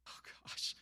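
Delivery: breathy whisper of dismay. Retold or imitated. Before preaching after bad news, whispered dismay. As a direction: breathe it out hopelessly.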